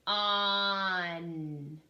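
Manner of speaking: On drawn out slowly as a single stretched sound; teacher sounding out a short word. Shown as a demonstration. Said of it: "let's sound out this word".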